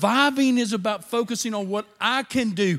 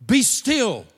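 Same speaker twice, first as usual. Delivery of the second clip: commanding rebuke; 4 dB louder, slower than usual. Acted. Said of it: "said to the waves".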